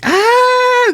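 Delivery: very high imitated squeal; delighted scream. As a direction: shriek like a thrilled little girl. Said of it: "She starts screaming in delight".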